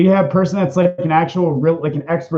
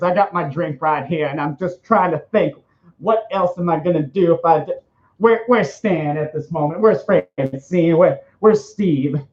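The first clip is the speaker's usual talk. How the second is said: campy, lispy cartoon fretting; put-on camp lisp; Roger the Alien. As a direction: fuss like a cartoon diva, drawing out the last questions. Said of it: "my Roger voice was just spot on".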